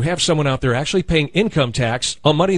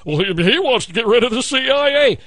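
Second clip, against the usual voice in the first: mocking Romney; 4 semitones higher than usual. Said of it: "he's laughing at Ron Paul".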